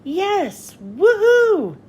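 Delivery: high, loud whoop of joy; thrilled boy whooping on his first flight; thrilled cheer. A narrator's reading. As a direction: cheer like you're flying for the first time. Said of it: "Hero was thrilled".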